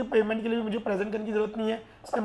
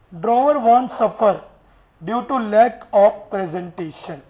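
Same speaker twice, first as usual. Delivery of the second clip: drawn-out, louder dictating voice; slow dictation; 4 dB louder, much slower than usual. Shown as a demonstration. Teacher slowly dictating the clause to the class. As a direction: spell the rule out word by word.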